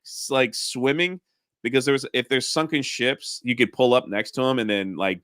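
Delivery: usual speaking voice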